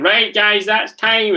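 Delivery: mimicked PE teacher wrapping up the game; imitating teacher. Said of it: "she was, like, saying".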